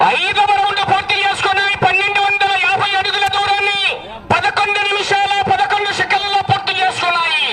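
sustained, even-pitched announcing chant; race announcer. Real, not acted. Announcer gives the crowd round time and team names, formal call. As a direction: call out the results over the ground.

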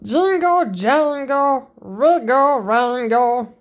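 ordinary delivery

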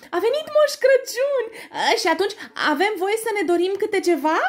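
very high, loud mouse voices; excited mice. Acted. The mice ask Santa for wishes, very high. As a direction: squeal with delight.

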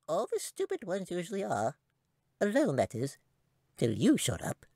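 a tiny creature mocking the girl; squeaky little voice. A narrator's reading. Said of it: "a little squeaky voice that barely sounded human".

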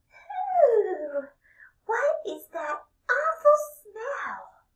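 very high, loud squeal of disgust; high little girl voice, yuck. Acted. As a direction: shriek it like a grossed-out schoolgirl. Little girl smells the cheese man.

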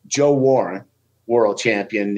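ordinary delivery

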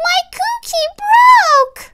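put-on high, slow child's complaint; dismayed wail. A narrator's reading. Child's dismay at broken cookie.

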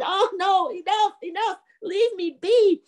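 high, wailing surrender; defeated ghost pleading. Acted. Ghost hit three times begs the man, high wailing plea. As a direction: wail for mercy, dragging out the end.

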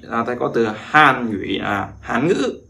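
ordinary delivery

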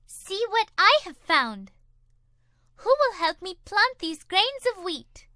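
a lightly voiced busy hen; eager hen voice. A narrator's reading. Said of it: "said the Little Red Hen".

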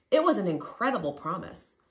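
ordinary delivery, nothing marked

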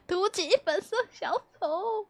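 playful pouty repeat of a nickname; mock whiny repeat. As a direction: pout it out like she's been wronged. Repeating chat's teasing nickname.

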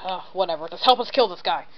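normal talking voice

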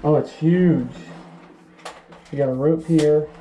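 muffled behind a dust mask; talking through a face mask. Real, not acted. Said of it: "Sorry for the muffled voice".

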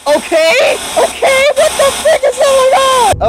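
very high, strained cry of alarm; startled shriek. Real, not acted. Gamer caught out by the game, startled shriek. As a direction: shriek it way up high, words stretched out.

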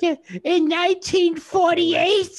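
put-on screechy old-lady voice, very high and loud; strangled, drawn-out crone shriek; Ethel. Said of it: "I'll give you Ethel".

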